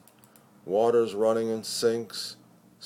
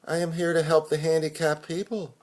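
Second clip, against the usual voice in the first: put-on young girl's voice; 6 semitones higher, faster than usual. Young girl at door voiced.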